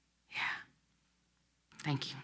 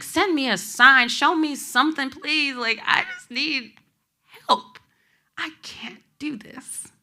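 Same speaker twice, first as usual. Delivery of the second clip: raised, pleading cry that breaks into a whisper at the end; desperate crying plea to God; 9 semitones higher, 6 dB louder than usual. Retold or imitated. Alone in the car, shouting out to God for a sign, crying. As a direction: beg God out loud, voice cracking. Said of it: "shouting out to God".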